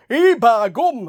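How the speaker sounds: hammed-up northern English "ee bah gum". Quoting someone demanding a Nottingham accent, mock northern accent. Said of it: "not a Nottingham accent. It's this".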